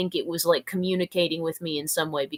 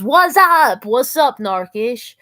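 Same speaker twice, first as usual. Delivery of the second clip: excited greeting; 5 semitones higher, 9 dB louder, slower than usual. Real, not acted. Reading chat comment excitedly.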